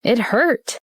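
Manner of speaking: ordinary delivery, nothing marked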